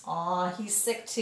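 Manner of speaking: ordinary delivery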